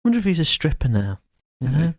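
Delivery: usual speaking voice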